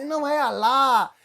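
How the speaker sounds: an angry shout correcting how "Alá" is said; furious correction. Retold or imitated. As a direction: bellow the second "Alá!" in a rage. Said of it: "o muçulmano ficou com raiva".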